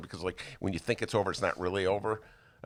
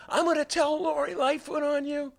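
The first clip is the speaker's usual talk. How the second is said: put-on much higher, childish tattle voice; tattletale threat; 4 dB louder than usual. Scolding the careless stranger in a high mock-tattling voice. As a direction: threaten like a kid on the playground. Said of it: "I was like".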